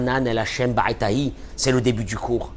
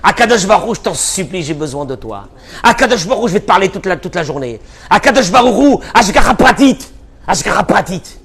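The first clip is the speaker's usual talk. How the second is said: fervent loud prayer; 7 semitones higher, 8 dB louder than usual. Retold or imitated. Acts out prayer to God.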